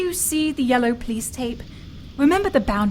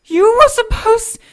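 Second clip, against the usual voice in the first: loud slow angry outburst; furious accusation; 5 semitones higher, 7 dB louder, slower than usual. Acted. Elizabeth raging at Harry for saving her over the girl. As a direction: hurl it at him.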